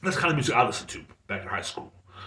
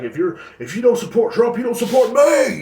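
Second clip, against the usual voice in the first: high, louder mock yell; mock outraged shout; 11 semitones higher, 7 dB louder than usual. Mocking people nowadays, high mock shout. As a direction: yell it like an offended partisan. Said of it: "that's how people are acting nowadays".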